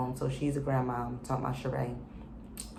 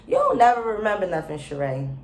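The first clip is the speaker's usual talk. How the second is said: scolding; 4 semitones higher, 6 dB louder than usual. Real, not acted. Scolding Sheree's convenient memory.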